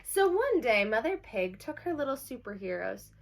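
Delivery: lively read-aloud for a class; bright picture-book reading; reading to children. Acted. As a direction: announce the title like storytime. Teacher reads the story aloud.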